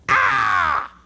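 loud, high yelp of pain; mock pained yell. Patient stabbed in the leg by his doctor, yelping. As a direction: cry out as if jabbed.

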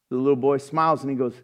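ordinary delivery

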